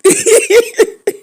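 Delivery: sudden high burst of laughter. Real, not acted. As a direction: break into a high squeal of a laugh. Laughing at the story.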